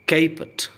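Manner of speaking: "caput" said high as "KAY-put"; model pronunciation. Shown as a demonstration. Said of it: "pronunciation पर ध्यान दें". ("caput" को "केपुट" ऊँचे स्वर में)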